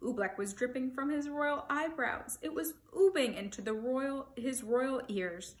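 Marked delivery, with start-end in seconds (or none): none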